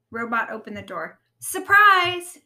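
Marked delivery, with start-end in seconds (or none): 1.4-2.4 high, loud surprise shout; excited yell. A narrator's reading. Rabbit surprises Robot at the door, yelling. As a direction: burst out to startle a friend. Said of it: "yelled Rabbit".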